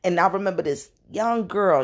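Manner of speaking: usual speaking voice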